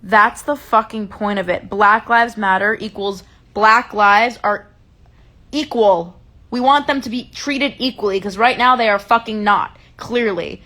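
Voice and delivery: loud, heated retelling of her own comeback; retold heated retort. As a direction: replay the argument with real fire. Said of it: "And I go".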